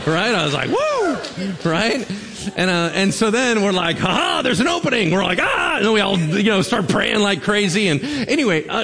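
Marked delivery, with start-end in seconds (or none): 0.7-1.7 high-pitched mock cheer; retold high woo of excitement; excited whoop. Retold cheer at friend's bold move. 4.0-5.1 high, loud retold triumph; mock-gleeful cry, pitched way up; gleeful excitement. Retold glee at an opening. 5.4-5.8 high, loud mock battle cry; retold high "ah" of excitement; excited yell. Acted charge into frantic prayer.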